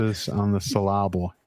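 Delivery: "emphasis" and "syllable" stressed on their middle syllables; jokey em-FASS-is on the sil-LAB-le; joke mispronunciation. Acted. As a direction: put the stress wrongly on em-PHA-sis and syl-LA-ble. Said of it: "as a joke".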